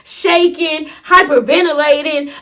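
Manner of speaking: normal talking voice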